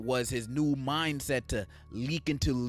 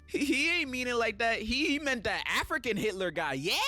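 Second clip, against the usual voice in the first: defensive fan excuse; 8 semitones higher than usual. Retold or imitated. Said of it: "B S claim like".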